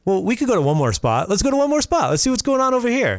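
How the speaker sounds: high, keen and rushing; eager party-goer. Retold or imitated. Voicing the party-lover.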